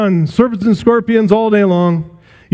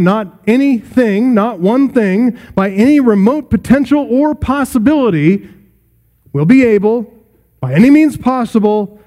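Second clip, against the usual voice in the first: rising preaching emphasis; 5 semitones higher than usual. Acted. Preacher pressing the promise on his hearers, rising emphasis. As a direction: build the point phrase by phrase.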